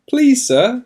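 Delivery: high, loud begging cry; desperate plea. Acted. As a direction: beg a stranger for your child's life. Ojciec błaga Jezusa o pomoc.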